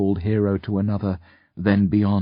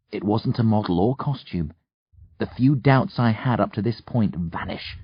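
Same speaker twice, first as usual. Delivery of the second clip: plain reading voice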